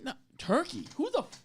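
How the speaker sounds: high, rising disbelief cut off; incredulous outburst. Retold or imitated. At the deli counter, reading the menu in high disbelief. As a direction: squeak out the outrage.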